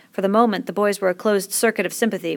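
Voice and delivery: ordinary delivery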